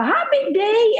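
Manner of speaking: put-on high, squeaky little kid greeting; little grandchild voice. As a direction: chirp it like a tiny grandbaby. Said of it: "I call".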